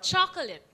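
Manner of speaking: chocolate as "CHAH-kuh-lit"; chocolate with reduced last vowels; English chocolate. Shown as a demonstration. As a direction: stress the start of chocolate, weak ending. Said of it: "It's not chocolate".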